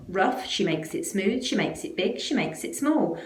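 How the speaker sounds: plain reading voice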